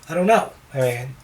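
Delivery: ordinary talk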